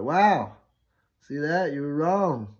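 loud, high mock amazement; crowing comeback. Student proving the teacher wrong, crowing comeback. Triumphant comeback after the figures.

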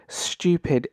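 ordinary talk

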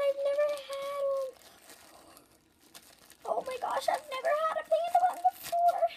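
high, fast giddy gushing; excited. Real, not acted. Excited over panda bun.

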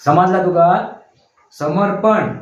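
usual speaking voice

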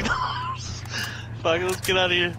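ordinary talk

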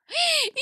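high, sharp gasp of delight; excited gasp. Real, not acted. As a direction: gasp at a lucky find. Spots many houses. (heyecanla tiz nefes çekme)

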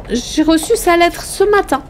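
high, uneasy cover story; high-pitched and defensive; anxious woman lying. A narrator's reading. Cornered woman keeps lying.

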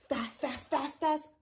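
rapid-fire repeats; fast. Shown as a demonstration. Teacher showing children fast by rattling it off. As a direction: rattle the word off quickly. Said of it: "fast and slow".

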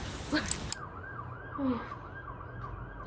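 long, airy, wide-open yawn; big yawn. Real, not acted. Tired student starting her vlog day, big yawn. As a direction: let a huge sleepy yawn out. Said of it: "that was a fat yawn".

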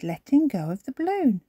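ordinary delivery, nothing marked

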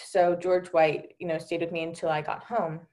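ordinary delivery

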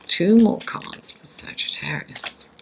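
soft unvoiced whisper to the deck; papery whisper. Real, not acted. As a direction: breathe the request in a whisper.

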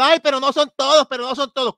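high, loud whiny imitation; a mock whimper of protest; mock whining. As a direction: whine it like a hurt listener. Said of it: "diciendo y llorando".

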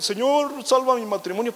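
high, loud hammed-up plea to God; proud spouse begging God to fix the marriage, hammed-up; mock pleading prayer. Mocking a proud spouse praying.

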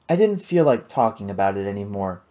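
ordinary delivery

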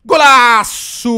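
long drawn-out goal shout; explosive goal commentary. Real, not acted. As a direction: stretch the golaço, full voice. Scores goal in the game.

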